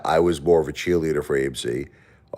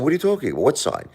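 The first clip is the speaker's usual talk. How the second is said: acted bafflement; 10 semitones higher, faster than usual. Baffled gambler answers pit boss.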